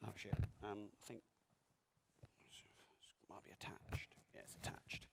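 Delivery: unvoiced aside under the breath; whispering. Real, not acted. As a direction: whisper it, off mic. Mic trouble, aside off mic.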